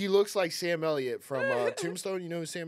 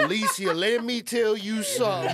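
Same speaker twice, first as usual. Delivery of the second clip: mock Southern drawl, raised and louder, laughing; 7 semitones higher, 8 dB louder than usual. Teasing her about her Southern boyfriend, mock Southern drawl. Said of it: "Does he have a Southern drawl".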